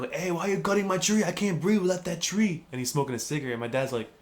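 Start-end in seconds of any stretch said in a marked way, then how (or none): 0.1-2.6 high-pitched mock complainer; whiny protest. Smoker griping at the man cutting his tree, whiny protest. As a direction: complain in a higher put-on voice.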